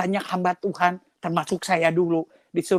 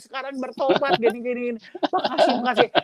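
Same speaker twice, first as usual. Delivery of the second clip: high vendor voice; 6 semitones higher, faster than usual. Retold or imitated.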